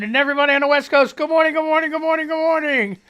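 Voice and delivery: jovial radio announcer hello; radio host greeting. Acted. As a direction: greet the whole country like a DJ.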